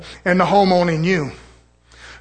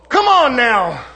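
ordinary delivery

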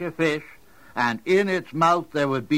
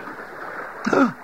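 gasp; 13 semitones higher than usual. Acted. Fish bites the line.

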